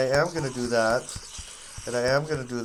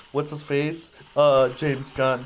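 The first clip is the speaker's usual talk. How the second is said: ordinary talk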